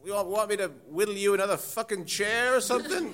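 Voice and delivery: high, mocking groundskeeper voice; sarcastic Basil. Groundskeeper sneers at the principal, high and sarcastic. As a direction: needle her with fake courtesy.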